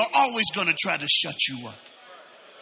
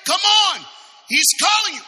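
high, loud acted call across a crowd; 14 semitones higher, 8 dB louder than usual. Preacher acting crowd summoning the blind man, loud call. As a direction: holler to hurry him over. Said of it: "They called out to the blind man".

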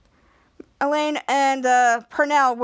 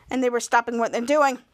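plain reading voice

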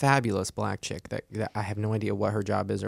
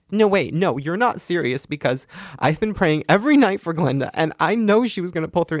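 high, loud, frantic pleading; mock frantic disbelief; 7 semitones higher, 7 dB louder than usual. Mom refusing to accept the TV death, high loud mock pleading.

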